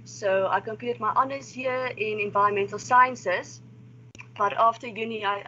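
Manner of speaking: usual speaking voice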